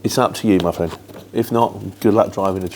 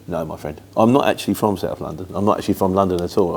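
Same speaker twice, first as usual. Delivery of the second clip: normal talking voice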